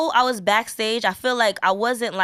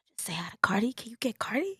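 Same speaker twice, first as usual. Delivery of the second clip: hushed sneaky whisper; 9 dB quieter, faster than usual. Retold or imitated. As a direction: whisper it like a secret. Sneaky request backstage.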